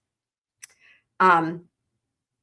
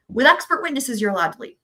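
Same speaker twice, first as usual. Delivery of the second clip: ordinary talk, nothing marked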